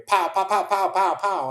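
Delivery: mock gunfire bursts; imitated gunshots. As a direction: spit out each pow like a pistol shot. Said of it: "I hear".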